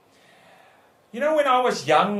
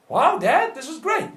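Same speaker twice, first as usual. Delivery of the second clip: higher, excited retelling of her delight; delighted daughter; 10 semitones higher than usual. Retold or imitated. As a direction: imitate a thrilled daughter, pitched high. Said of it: "Her eyes lit up".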